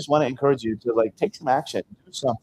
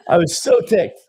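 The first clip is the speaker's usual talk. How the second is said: laughing; 5 semitones higher, 5 dB louder, slower than usual. Real, not acted.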